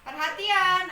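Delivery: loud Perhatian with a lift; calling for attention. Shown as a demonstration. Teacher models calling students to attention. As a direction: call the class to order. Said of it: "menginstruksikan atau bilang".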